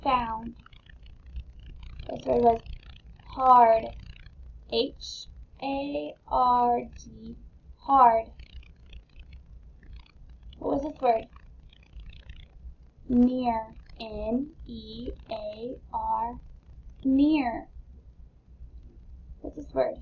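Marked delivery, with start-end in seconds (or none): 4.7-7.4 each letter slow and separate; teacher spelling aloud; spelled out slowly. Shown as a demonstration. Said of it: "Let's spell it out loud". 14.0-16.3 each letter slow and separate; teacher spelling aloud; spelled out slowly. Shown as a demonstration. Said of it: "Let's spell it out loud".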